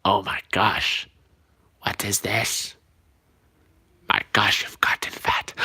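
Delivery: low, hushed character voice; soft, low mock dismay; Edna Mode. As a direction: utter disbelief in a low put-on voice. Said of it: "Edna Mode".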